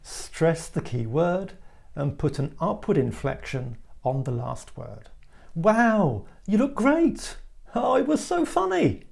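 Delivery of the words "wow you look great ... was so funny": high-pitched, gushing exclamations; excited exclamation. Shown as a demonstration. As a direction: gush with amazement, key words punched. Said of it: "The sentence should sound exciting or amusing".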